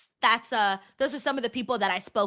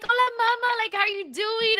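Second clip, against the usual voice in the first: higher put-on voice copying a friend's greeting; imitating Lola; 9 semitones higher than usual. Retelling how Lola greeted her, higher mimicking voice. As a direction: play the sweet friend saying hello. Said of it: "She was like".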